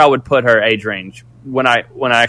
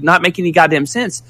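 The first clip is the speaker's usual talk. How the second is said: ordinary talk, nothing marked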